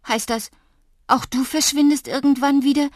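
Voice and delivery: loud, high, dismayed question; alarmed. A narrator's reading. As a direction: cry out in alarm at losing a friend. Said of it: "Mir wurde kalt und zittrig". (laut und erschrocken)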